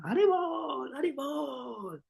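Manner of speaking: high, slow, drawn-out calls of Haribol; called-out cry. Retold or imitated. Parvati calling out to dancing Shiva, high drawn-out cry. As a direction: call him back from far off, stretching each Haribol. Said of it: "Le chilla".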